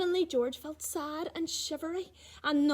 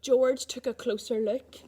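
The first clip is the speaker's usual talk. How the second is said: ordinary delivery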